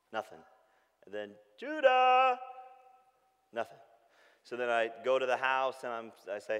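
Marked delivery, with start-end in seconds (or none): 1.6-2.4 higher, louder call across the yard. Retold or imitated. Dad calling for his missing son, louder.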